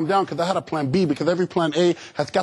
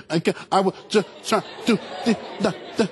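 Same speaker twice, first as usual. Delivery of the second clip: stuttering words between mock sobs; choked crying speech; 2 semitones higher, faster than usual. Shown as a demonstration. Crying kid answering parent's question, choked sobbing stutter. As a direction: gasp out each syllable, hiccuping with tears. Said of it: "And you sound like this".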